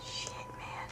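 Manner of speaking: voiceless whispered curse. Real, not acted. Player cursing at the dark level in a whisper. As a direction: breathe it out under the breath.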